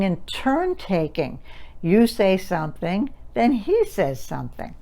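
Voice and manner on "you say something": ordinary talk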